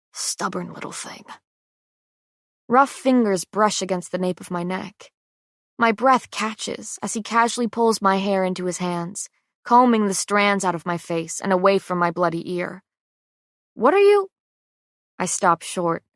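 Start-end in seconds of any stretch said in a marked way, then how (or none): 0.1-1.2 muttered under breath. A narrator's reading. Prince mutters about stubborn Gray, soft mutter. 13.7-14.3 sudden high, loud startled question; surprised. A narrator's reading. Gray startled by the prince's touch, high surprised blurt. Startled by his gentle braiding.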